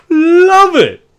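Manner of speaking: high, loud cheer; excited shout. Acted. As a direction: yell it like a hyped announcer. Crowd reaction to die roll.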